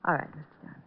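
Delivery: soft, resigned; soft, defeated giving in; resigned surrender. Acted. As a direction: give up quietly. Killer gives up.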